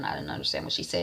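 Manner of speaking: fast, breathy whispered aside. Real, not acted. Viewer aside about missed dialogue, whispered fast. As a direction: drop to a quick whisper as an aside.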